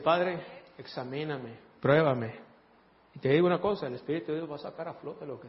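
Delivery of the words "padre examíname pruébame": soft, slow, low plea; humble prayer. Shown as a demonstration. Preacher showing the church how to pray, soft plea. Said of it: "te vas a tener que atrever a decir".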